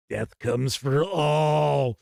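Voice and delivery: very slow, drawn-out mock prophecy; mock doom pronouncement. Game master reading out the chat's running joke, mock doom pronouncement. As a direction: stretch each word into a playful pronouncement of doom.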